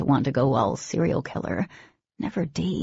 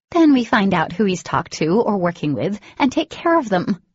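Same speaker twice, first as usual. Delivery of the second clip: angry insistence; 4 semitones higher, 6 dB louder than usual. A narrator's reading. Dee insists on killing.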